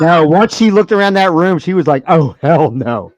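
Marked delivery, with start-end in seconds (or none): 2.1-3.1 low, drawn-out imitated refusal; retold firm refusal. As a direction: stretch it out slowly, mock-appalled. Retold flat refusal at party.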